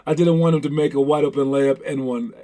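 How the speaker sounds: ordinary talk, nothing marked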